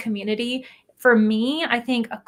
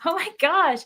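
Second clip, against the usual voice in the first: mock fright; 7 semitones higher than usual. Real, not acted. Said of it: "unrational fears list".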